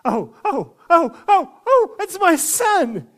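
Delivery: loud, high shouts building to a drawn-out cry; explosive, high-pitched yell of a father spotting his child; overjoyed outcry. Retold or imitated. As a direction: cry out in full-voiced astonished recognition. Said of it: "he says something like this".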